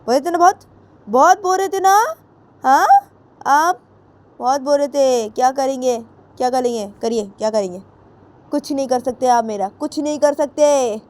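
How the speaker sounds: high mocking taunt; daring them to try something; defiant taunting. Jiya taunts Ritu's parents, a lightly voiced high defiant taunt. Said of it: "तो जिया बोलती".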